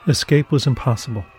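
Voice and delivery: plain reading voice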